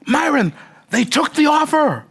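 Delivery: high, slow Aussie announcing good news; triumphant Australian voice; mock-thrilled Australian report; thrilled Australian accent. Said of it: "my Australian accent's not that good".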